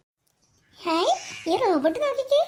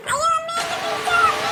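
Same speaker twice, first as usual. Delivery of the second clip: frightened cry; 7 semitones higher than usual. Acted. Child floats away, cries for help.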